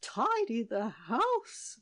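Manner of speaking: slow, drawn-out moan.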